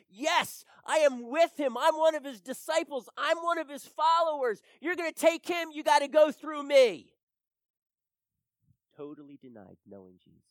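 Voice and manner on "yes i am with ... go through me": loud, high, bold declaration at full voice; defiant shout. Acted. Preacher voicing what Peter should have said, defiant shout. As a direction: shout it like standing up to a crowd. Imagines Peter standing up for Jesus.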